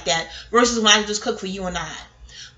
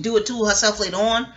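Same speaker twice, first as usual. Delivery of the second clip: ordinary delivery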